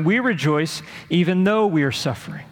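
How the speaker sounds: "even though" lifted and pressed; stress on EVEN THOUGH. Shown as a demonstration. Preacher contrasts stoicism with the verse, stressing "even though". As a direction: punch "even though" as the swapped-in words. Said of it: "instead of in our suffering".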